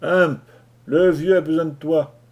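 loud call down the hatch. Acted. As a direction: call out to be heard below. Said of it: "Latimer appela par l'écoutille".

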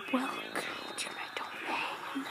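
unvoiced secretive whisper; mysterious rescuer whispers a command. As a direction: breathe it like a shadowy hero.

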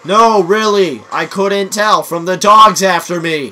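high, loud, slow sarcastic reply; loud sarcasm. Real, not acted. Friend warns of dogs chasing player, loud sarcasm.

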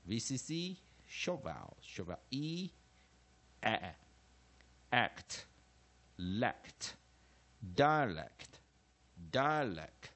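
measured classroom recitation in separate phrases; chanted phonics drill, firm on each term; syllable practice drill. Shown as a demonstration. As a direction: recite each rule like a drill for students to repeat. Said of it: "我们做最后一次练习音节的方式".

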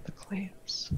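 breathy hushed whisper. Pretend. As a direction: whisper it like a secret. Echoing the joke in whisper.

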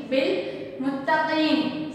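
"बिल मुत्तकीन" slowly, final n left without a vowel; waqf stop. Shown as a demonstration. Teacher repeating for students, slow "बिल मुत्तकीन" closing on n. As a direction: recite "बिल मुत्तकीन", long ee then stop on n. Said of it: "साकिन कर देते हैं".

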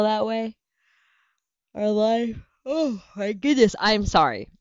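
words swallowed by a real yawn; apologetic, mid-yawn. Real, not acted. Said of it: "and I'm yawning".

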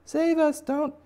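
very high, loud hammed-up plea; pleading crowd. Crowd begging the bunny for mercy, shrieked mock plea. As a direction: beg at the top of your range. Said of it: "And they're like".

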